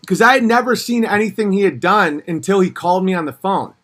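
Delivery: normal talking voice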